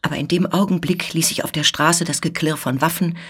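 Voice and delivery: plain reading voice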